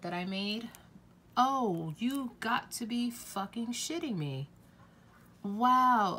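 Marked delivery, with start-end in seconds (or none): 1.4-4.5 sudden high disbelieving cry; real shock, high-pitched; shocked outburst. Real, not acted. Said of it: "that just totally caught me off guard". 5.4-6.2 loud, high stunned wow; genuinely floored; real shocked exclamation. Real, not acted. Reacting to shattered palette.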